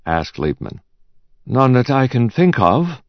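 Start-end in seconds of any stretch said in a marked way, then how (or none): 1.5-3.0 confident, higher-pitched reply. A narrator's reading. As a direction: answer with easy assurance. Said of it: "replied Ruth confidently".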